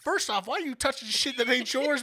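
chewing out the roommate over the phone, indignant. Retold or imitated. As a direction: scold him for touching your stuff. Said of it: "I was like".

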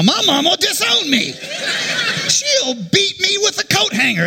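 high-pitched frantic protest, hammed up; mock panic. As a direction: squeal in dread of mama. Acted panic about mama's punishment.

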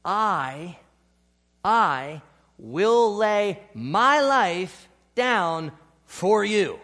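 loud, very slow, bold declaration; booming, drawn-out pledge of loyalty; bold vow. Acted. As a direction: proclaim it slowly and boldly like a proud oath. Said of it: "Peter had told Jesus this".

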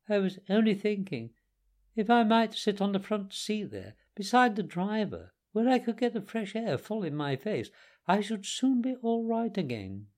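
high-pitched, polite Toad voice; meek, wheedling Toad. Acted. Toad asking the gentlemen for the front seat, meek and wheedling. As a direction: plead your case, pitched well up.

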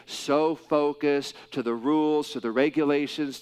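ordinary talk

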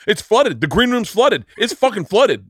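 high-pitched mock outrage, hammered repeatedly; super aggressive. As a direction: imitate an aggressive complainer, pitch up. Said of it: "this is super aggressive".

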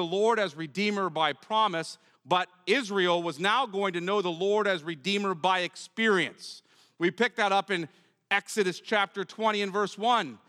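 loud, ringing pulpit proclamation; preaching at full force. Acted. Preacher declaring God's promises to the congregation, full force.

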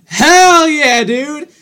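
high, loud victory cheer; excited whoop. Real, not acted. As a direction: cheer like you just won. Celebrating after the tongue twister.

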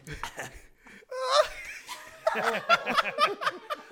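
high-pitched strangled squawk; seagull cry. Pretend. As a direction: screech like a choking gull. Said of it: "channel your inner seagull".

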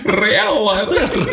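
high, shrieking laugh running into the words; laughing hard. Real, not acted. Cracking up at the drinking-game answer, high and laughing. Bursting out at the joke.

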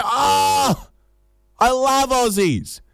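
very high, drawn-out delight; gushing enthusiasm. Acted. Foreigner learning the traveller is Australian, gushing enthusiasm. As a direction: gush it slowly in a high swooning pitch. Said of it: "maybe we need more enthusiasm".

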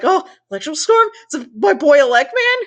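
high, quick, eager questioning; mock excited hope. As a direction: perk up fast at a favorite's possible return. Acts out own hopeful reaction.

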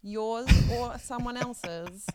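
loud rapid proclamation; rally the crowd, loud and fast; town crier. Pretend.

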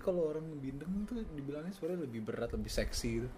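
stuffy nasal voice; talking with a blocked nose. Real, not acted. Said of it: "bindeng banget sih".

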